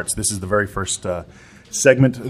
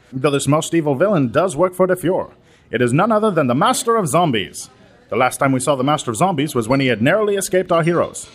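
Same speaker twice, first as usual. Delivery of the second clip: put-on higher, punchy old-time radio announcer; 6 semitones higher than usual. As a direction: sell the show like a vintage broadcast. Radio show opening announcer.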